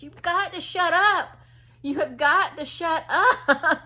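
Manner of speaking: high, forceful plea short of yelling; exasperated imitation. Young man who lost it with the talker, exasperated imitation. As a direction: stress it hard, slowing on the repeat. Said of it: "he wasn't even, like, yelling".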